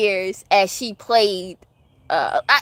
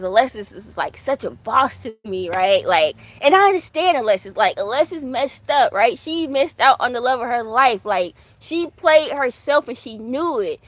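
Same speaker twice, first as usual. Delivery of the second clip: ordinary talk, nothing marked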